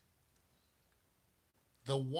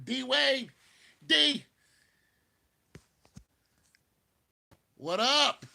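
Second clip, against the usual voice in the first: loud, high holler; calling out to D Wade; 10 semitones higher, 12 dB louder, slower than usual. Acted. Aging LeBron looking to D Wade for help, calling out. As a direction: yell out for a teammate's attention. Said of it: "He'll be looking at D Wade going".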